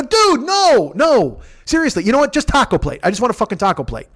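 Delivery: high, loud-edged plea that eases down; acted-out exasperation, pitched high; exasperated protest. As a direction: retell it as fed-up pleading at the counter. Retelling his frustrated food order.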